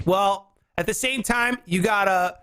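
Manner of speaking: ordinary talk, nothing marked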